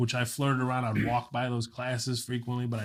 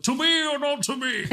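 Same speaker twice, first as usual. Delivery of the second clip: high-pitched theatrical British delivery; mock-British stage voice, pitched way up; British accent; 3 dB louder than usual. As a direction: declaim it like Shakespeare in a hammed-up British accent. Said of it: "I was doing this British accent".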